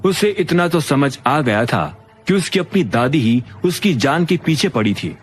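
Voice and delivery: ordinary delivery, nothing marked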